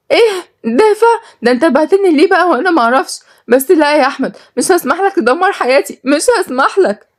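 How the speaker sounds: high-pitched, indignant woman's voice; outraged protest. Acted. Sister learning her brother sold her, outraged.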